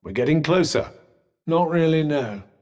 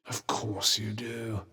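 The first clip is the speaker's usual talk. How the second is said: low, hushed and slow; soft, drawn-out murmur under the voice; muttered aside; 8 semitones lower, 11 dB quieter, slower than usual. Acted. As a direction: drop it to a near-whispered aside.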